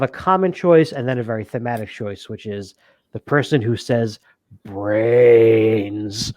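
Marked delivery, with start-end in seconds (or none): 4.6-6.3 long drawn-out zombie moan; zombie impression. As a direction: moan it slowly like a hungry zombie. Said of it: "who did the best zombie impression".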